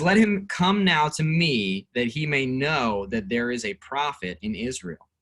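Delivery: slow, measured Bible reading; slow, formal reading of scripture to the class. Acted. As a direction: read the verse out slowly and deliberately. Reads the Bible passage aloud.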